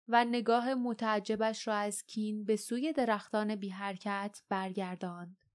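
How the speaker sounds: usual speaking voice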